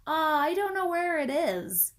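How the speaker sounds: boy pretending to look; feigned ignorance. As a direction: lie breezily. Jason lying.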